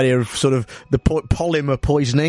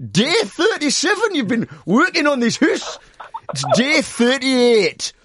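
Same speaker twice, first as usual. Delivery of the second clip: Geordie gaffer; 8 semitones higher than usual. Acted. Role play as gaffer.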